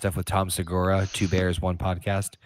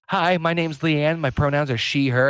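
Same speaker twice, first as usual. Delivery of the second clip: pronoun parent; 6 semitones higher than usual. Retold or imitated. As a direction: imitate an earnest parent introducing herself. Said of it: "parent stood up and was like".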